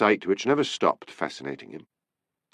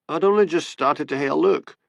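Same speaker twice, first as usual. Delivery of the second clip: higher, sulky Highland grumble; petulant excuse to the boss; 8 semitones higher than usual. A narrator's reading. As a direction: whine it like a caught-out lodger. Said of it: "said Dick petulantly".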